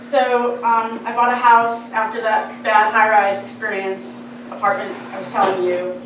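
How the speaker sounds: normal talking voice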